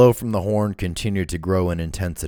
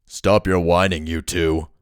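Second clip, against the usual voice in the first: loud, low, rough gang leader; 5 dB louder, slower than usual. A narrator's reading. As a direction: bark it like a rough boss. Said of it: "low and rough".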